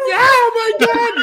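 high, loud hammed-up crying; mock sobbing winner. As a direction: wail it like an overcome winner.

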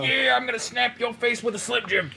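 high-pitched wrestler catchphrase voice; Macho Man. Acted. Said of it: "You and Slim Jims, man".

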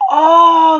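loud high burst of delight; sudden excited shout. Real, not acted. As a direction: blurt out with joy.

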